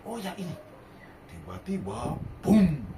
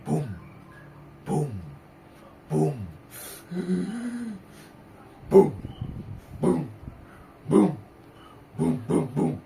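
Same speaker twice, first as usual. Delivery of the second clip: booming footsteps; 6 dB louder than usual. Acted. Giant footsteps sound effect.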